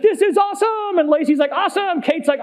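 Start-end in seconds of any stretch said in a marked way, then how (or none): 0.0-1.0 high, elated mock cheer; acted-out ride excitement; imitated thrilled kid. Kids cheering on spinning ride. 1.5-2.0 high mock cheer; acted-out elation; imitated thrilled rider. Wife cheering on spinning ride.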